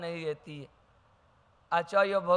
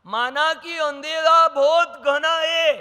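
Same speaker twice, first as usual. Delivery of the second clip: reciting a poem line; 7 semitones higher, 9 dB louder, slower than usual. Shown as a demonstration. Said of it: "क्या लिखा है?".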